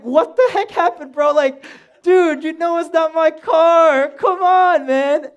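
loud, high-pitched retold rant at a buddy; pissed-off outburst. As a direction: reenact yelling at your friend in disbelief. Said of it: "I was so pissed".

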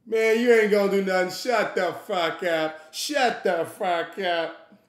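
drawn-out, dismissive telling-off; lightly voiced heckler. Drunk man shutting his friend up, lightly voiced and drawn out. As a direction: stretch out the put-down in a lightly put-on voice.

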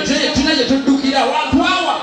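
usual speaking voice

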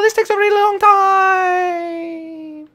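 high, slow, drawn-out call; slow stretched yell. Acted. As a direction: stretch it out while falling. Falling through the portal.

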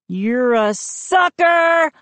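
high, slow, drawn-out taunt; gleeful exclamation. A narrator's reading. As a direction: exclaim it as a gotcha. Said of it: "I exclaimed".

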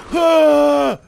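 high, loud "aah"; startled scream. Real, not acted. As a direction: scream out at a sudden attack. Startled while fighting zombies.

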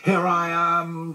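high, drawn-out little call; high childlike squeal; squeak. A narrator's reading. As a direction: pipe up in a tiny squeak. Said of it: "squeaked Roo".

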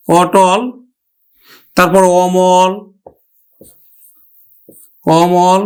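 অটল and অমল with an open অ; teacher models the open vowel; pronunciation demo. Shown as a demonstration. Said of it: "যেমন অটল".

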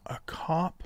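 hushed, very soft whisper. Real, not acted. Gamer to viewers, keeping hidden because they can hear the mic, whispering. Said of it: "Gotta whisper because they can actually hear me".

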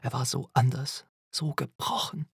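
usual speaking voice

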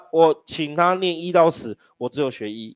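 usual speaking voice

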